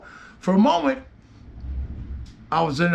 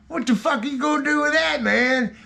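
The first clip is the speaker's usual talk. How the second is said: mocking higher challenge, daring him; scene: cornered by his drunk uncle, tossing back a higher mock dare; defiant taunt; 8 semitones higher than usual. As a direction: sneer it at him, half-laughing defiance.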